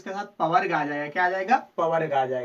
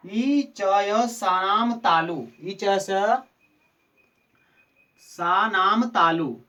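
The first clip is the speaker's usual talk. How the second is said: slow, deliberate chant of ई च य सानाम तालु; सूत्र धीरे-धीरे पाठ करना; sutra recitation; 4 dB louder, much slower than usual. Shown as a demonstration. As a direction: recite the rule slowly for students to memorise.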